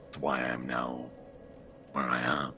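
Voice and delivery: ordinary talk, nothing marked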